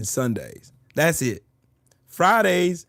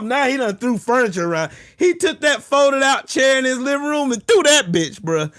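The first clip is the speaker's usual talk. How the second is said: normal talking voice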